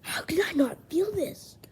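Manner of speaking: amazed. Real, not acted. Amazed at metal card.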